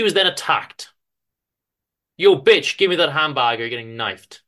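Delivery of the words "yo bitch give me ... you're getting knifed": loud, rough street-thug demand; mugger threat. Acted. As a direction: bark it at her as a mugger. Mugger attacks Madeline.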